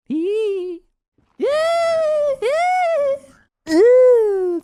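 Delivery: high loud shriek. Real, not acted. Streamer shrieking with joy at the game. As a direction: shriek in excitement. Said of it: "Désolé pour le cri".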